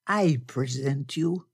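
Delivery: slow, ceremonious courtesy; courteous. A narrator's reading. Blackbeard presenting the major to a lady, slow courtesy. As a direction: make a formal introduction, unhurried. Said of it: "Blackbeard said courteously".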